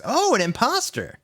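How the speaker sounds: high, put-on falsetto aside; mock voice. Reader mocking the fake author in a high put-on voice. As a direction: squeak it out in mock surprise.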